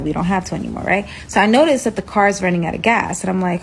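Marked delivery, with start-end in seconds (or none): none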